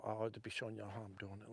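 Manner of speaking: muttered aside. Real, not acted. Aside while working the rod.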